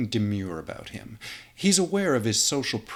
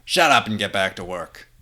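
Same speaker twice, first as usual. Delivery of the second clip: loud, brusque dismissal; gruff boss order; 9 dB louder than usual. Acted. Gruff editor ordering Clark back to work, loud. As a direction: bark it and move on.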